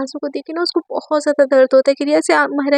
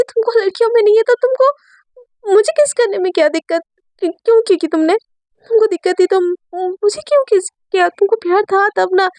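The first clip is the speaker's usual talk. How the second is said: angry reproach; 6 semitones higher than usual. Acted. Myra's angry reproach to Riya.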